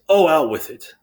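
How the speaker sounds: high, loud, impatient outburst; annoyed. Acted. King prods the hesitant sentry to speak, annoyed. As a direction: cut in with exasperation. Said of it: "Creon starts to get annoyed".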